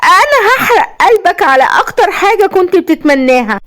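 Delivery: high, deranged vengeful threat; unhinged villainess, pitch climbing with spite; gloating. A narrator's reading. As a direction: build from gloating to a crazed vow. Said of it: "وابتسمت بشماتة وقالت".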